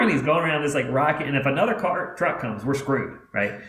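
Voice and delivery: ordinary delivery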